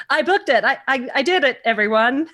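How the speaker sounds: high, loud victory cry; triumphant shout. Retold or imitated. Announcing to an empty house that she booked it, high and loud. Alone at home, celebrating booking.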